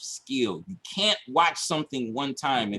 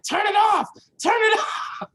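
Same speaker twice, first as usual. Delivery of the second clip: high, loud, urgent shout; TubeBuddy rep shouting to stop the ads; 5 dB louder than usual. Retold or imitated. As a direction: yell it like an emergency. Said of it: "He's like".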